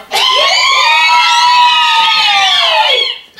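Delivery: very high, long, loud squeal; stuck-pig squeal. Acted. Audience squealing as the frightened pig, piercing squeal. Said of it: "squealed like a little stuck pig".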